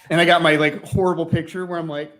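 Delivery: ordinary talk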